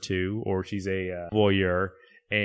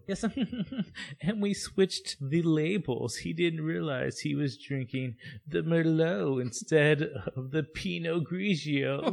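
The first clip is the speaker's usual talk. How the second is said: giddy snob voice; 8 semitones higher than usual. Acted. Voicing giddy Niles.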